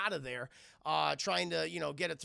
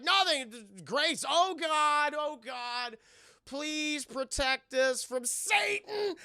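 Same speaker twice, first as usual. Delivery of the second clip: high, loud mock praying; hammed-up desperate plea; panicked prayer; 9 semitones higher, 6 dB louder, much slower than usual. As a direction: wail a stretched-out grace to cover your panic. Boy covers panic with frantic prayer.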